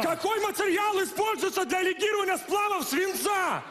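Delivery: shouting it to the whole room. Acted. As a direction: yell the question. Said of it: "Чё ты орёшь?".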